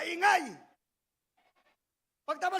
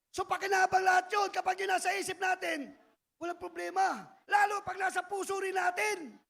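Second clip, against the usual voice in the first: loud, driving preacher's voice through every line; loud preaching; 4 semitones higher, 7 dB louder than usual. Acted. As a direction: preach it like the praise itself, full volume. Preacher at full force. (malakas at masiglang pangangaral)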